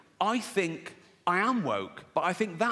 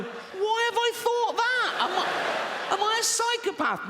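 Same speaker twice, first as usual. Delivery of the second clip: much higher, slow, laughing fretful squeak; mock panic; slower than usual. Commuter horrified by own thought, high laughing panic.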